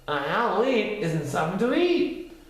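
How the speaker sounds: high, snippy rabbit voice; snorting Rabbit. A narrator's reading. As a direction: scoff at Pooh like a fussy rabbit.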